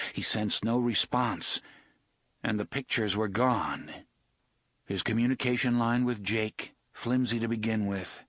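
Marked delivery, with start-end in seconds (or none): none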